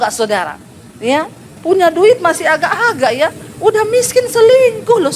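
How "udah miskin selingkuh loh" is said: much higher, louder indignant "selingkuh"; outraged preaching. Acted. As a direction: cry out in disbelief at them. Preacher scolding unfaithful husbands. (berseru geram)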